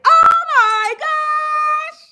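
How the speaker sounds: sung high, slow and drawn out; stretched sung gasp of delight; oh my gosh. Pretend. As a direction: belt it out as a shocked squeal. Imagined reaction, sung high.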